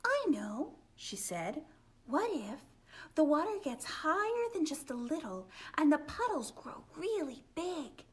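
put-on high, eager little girl; lightly voiced excited girl; excited idea. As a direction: bubble over with a new idea.

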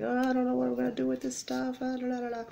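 high, quick sing-song self-mockery; mock fretting. To viewers, retelling her own dithering, high quick sing-song. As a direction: ham up her own dithering over craft stuff, then trail into la la la. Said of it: "I was like".

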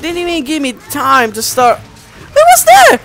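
high, loud frustrated yell. Real, not acted. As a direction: cry out at the unfair death. Dies in game, protests unfairness.

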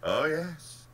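high, loud, airy aside; lilting offhand remark; casual. A narrator's reading. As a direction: toss it in casually. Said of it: "he said casually".

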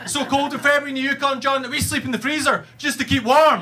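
high, strained, urgent boasting; storming-in one-upper. Acted. Stranger storms into the winter conversation to outdo everyone, hammed-up boasting. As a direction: barge in high-pitched and insistent. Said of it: "will just come storming over".

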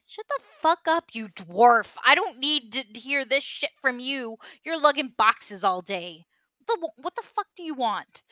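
loud, high, angry outburst; furious shout. Acted. Fiery bartender shouting at the dwarf who nagged him. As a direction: snap back at the nagging dwarf. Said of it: "starts getting agitated and angrier".

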